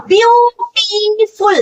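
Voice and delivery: ब्यूटीफुल stretched slowly, every syllable long; drawn-out syllables. Shown as a demonstration. Teacher showing students how long the word sounds, slow and stretched. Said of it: "जिसका pronunciation लंबा हो".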